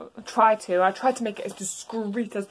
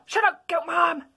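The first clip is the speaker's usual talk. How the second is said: bratty yell; 7 semitones higher than usual. Acted. Azzy yelling at Toriel, high bratty shout. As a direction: yell back like a brat. Son yells back.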